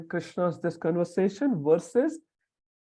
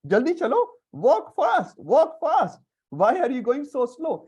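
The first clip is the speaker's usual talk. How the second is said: high, loud mock yell urging him on; bossy boy's shout; 9 semitones higher, 6 dB louder than usual. Sridham riding Krishna's back, hurrying him in a high mock shout. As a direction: bark it like an impatient kid riding a pony.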